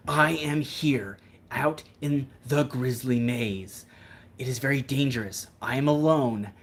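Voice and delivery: slow, dramatic nature-filmmaker voice; earnest wilderness narrator building to alarm; Timothy Treadwell impression. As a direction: play the lone man among bears for the camera. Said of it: "That was my Timothy Treadwell impression".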